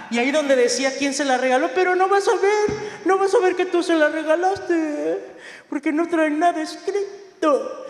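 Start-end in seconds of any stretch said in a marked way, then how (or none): 1.7-7.8 high-pitched, drawn-out protest; slowing, high singsong objection; acted complaint. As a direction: object in a raised voice, stretching the endings.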